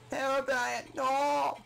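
high, loud wail; mock anguished cry. Joking mourner of dead Herobrine, high mock wail. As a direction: cry out in hammed-up despair.

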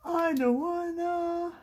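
put-on high, drawn-out childlike whine; mock whining. Crafter balks at gluing down her labels, mock whining. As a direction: whine it slowly in pretend misery. Playful reluctance to glue.

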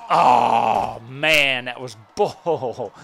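loud, drawn-out angry yell; frustrated shout. Real, not acted. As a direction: blast it out, fed up. Loses the bout, frustrated.

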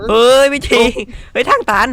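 loud, high cry of alarm; panicked shout. Real, not acted. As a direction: yell in panic at the dead end. Chased into dead end.

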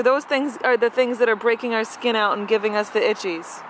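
usual speaking voice

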